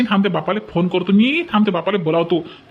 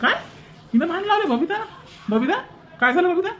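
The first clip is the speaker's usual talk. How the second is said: angry demand; 9 semitones higher, 4 dB quieter than usual. Acted. Husband confronts wife in quarrel.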